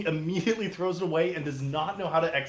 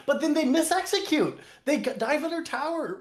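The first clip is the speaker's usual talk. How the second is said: excited exclaim; 10 semitones higher, 3 dB louder than usual. Real, not acted. Caster exclaiming at team mistake.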